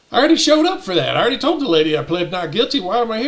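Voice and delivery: fast, high-pitched objection; retold indignant outburst, starting high and loud; exasperated protest. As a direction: burst out in rapid disbelief.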